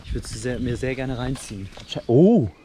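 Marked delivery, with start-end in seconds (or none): 2.1-2.5 startled. Real, not acted. Sudden exclamation.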